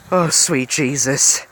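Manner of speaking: slow, breathless gasp of relief. A narrator's reading. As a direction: let it out on a shaky breath of relief. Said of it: "Usobi gasped".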